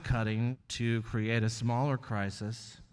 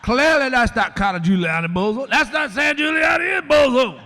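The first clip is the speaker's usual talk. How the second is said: loud, much higher preacher-style denial; Al Sharpton impression; 13 semitones higher, 11 dB louder than usual. Roseanne mocking Sharpton's denial for the host, loud and high. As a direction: boom it out like a fired-up Sharpton. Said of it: "I heard Sharpton say".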